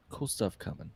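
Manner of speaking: ordinary talk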